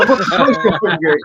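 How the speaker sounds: half-laughing mock outrage at his daughter; laughing retold exasperation; incredulous dad.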